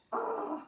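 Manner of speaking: high hee-haw; squeaky hee, then haw; donkey bray. Pretend. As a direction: imitate a donkey for children. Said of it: "The donkey makes a noise like this".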